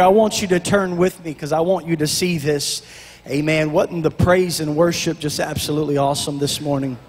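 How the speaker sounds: usual speaking voice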